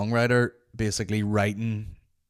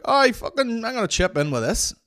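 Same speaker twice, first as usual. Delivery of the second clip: high, louder eager heckler voice; chipping-in heckler; 13 semitones higher, 5 dB louder than usual. Retold or imitated. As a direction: butt in like a cocky punter. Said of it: "they would just be like".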